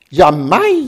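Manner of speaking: high, loud Austrian cheer; cheering Salzburg gal. A narrator's reading. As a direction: whoop it like a jolly Salzburg matron. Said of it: "jubelte die Salzburger Alpentrine".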